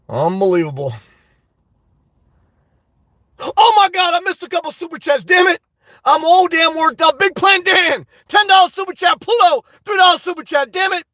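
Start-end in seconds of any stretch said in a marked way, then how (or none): 3.4-11.0 calling out donors in a worked-up rush; flustered excitement. Real, not acted. Streamer who missed super chats, excited and flustered.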